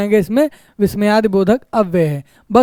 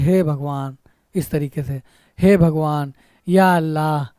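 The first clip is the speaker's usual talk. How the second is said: sorrowful; 5 semitones lower, 4 dB quieter, slower than usual. Shown as a demonstration. Teacher demonstrates grief for students, low and soft sorrow. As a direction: lower and softer, a grieving sigh of a prayer. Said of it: "जब हम दुखी होते हैं तो कहते हैं".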